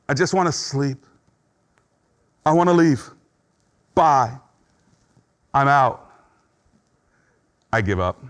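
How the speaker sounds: voicing depression. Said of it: "Depression says".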